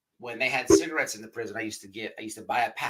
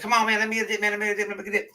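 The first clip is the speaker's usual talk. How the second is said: put-on high, insistent country-accent begging; big country accent; 8 semitones higher, much faster than usual. Inmate pestering his buddies for a dip, country accent. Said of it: "he had this big old country accent".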